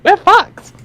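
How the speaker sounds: high squeaky "we're fucked"; mock despair. Real, not acted. Friend reacting to doomed planet, high mock despair. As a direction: squeak the doom.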